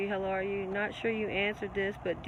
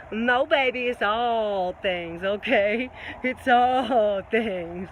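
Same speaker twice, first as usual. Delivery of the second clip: loud, slow, fervent emphasis; building fervour, slowing on all things; excited preaching; 4 dB louder, much slower than usual. Real, not acted.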